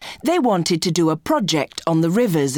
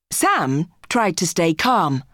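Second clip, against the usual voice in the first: ordinary delivery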